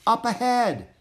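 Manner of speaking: high, loud call; shouting. A narrator's reading. Annie pointing Jack toward the bells, shouting. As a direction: call out what lies ahead. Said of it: "Annie shouted".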